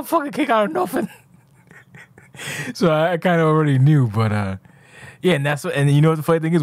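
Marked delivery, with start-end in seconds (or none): 0.0-1.1 high-pitched mock griping; imitated rant. Retelling a beaten rival's complaint, high mock rant. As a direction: mimic his sore-loser rant up high.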